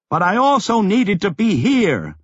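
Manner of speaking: calling out. A narrator's reading. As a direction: insist over the howling wind. Last words shouted over the wind.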